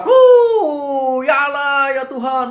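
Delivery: loud high wail sliding into pleas; imitated panicked screaming. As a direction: mimic a planeload crying out to God. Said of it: "Oh, semuanya teriak".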